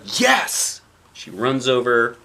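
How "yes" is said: excited yes. A narrator's reading. Girl answers playground offer.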